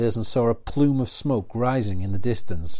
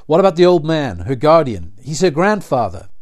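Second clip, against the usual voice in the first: louder, higher, pressing demand; urgent plea; 5 semitones higher, 9 dB louder than usual. A narrator's reading. Daniel urgently presses the riders about the grandfather, louder. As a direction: forget your place and insist.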